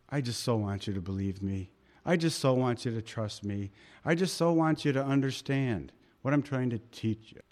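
soft, low and pleading; gentle, not angry. Retold or imitated. Pastor voicing Jesus longing for trust, soft and tender. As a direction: plead tenderly, no anger at all. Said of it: "I don't think he was angry here".

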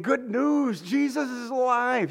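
high-pitched, exultant announcement; joyful proclamation. Acted. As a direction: proclaim it high, as great news. Acts out proclaiming the good news.

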